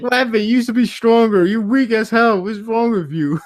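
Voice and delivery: high-pitched, animated jeering quote; hammed-up scolding, pitched high; mock heckling. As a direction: heckle like a let-down fan. Acts out characters' cliché heckling lines.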